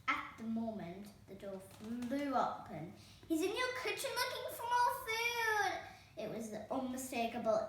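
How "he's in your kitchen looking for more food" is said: put-on high, shrill child shout; ear-piercing Goldilocks. A narrator's reading. Goldilocks tells the stepmother where Herb is, piercing. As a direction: pierce the room telling on him. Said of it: "ear-piercing voice of Goldilocks".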